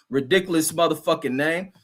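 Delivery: usual speaking voice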